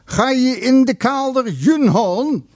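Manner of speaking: high, slow thick dialect; an old farmer's drawl, barely understandable. Acted. Uncle sending the boy to the cellar, in thick dialect.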